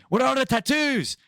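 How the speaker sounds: high-pitched, badly faked Irish accent; hammed-up high Irish voice; mock Irish wife. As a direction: mimic a wife in a silly Irish falsetto. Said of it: "I don't know what l-, accent".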